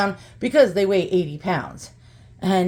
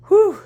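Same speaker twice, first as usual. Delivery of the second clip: a loud, high puff of relief after heavy lifting; breathless high whew; 12 semitones higher, 7 dB louder than usual. Real, not acted. After dragging the heavy sculpture in, a real winded whew. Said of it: "I am out of breath".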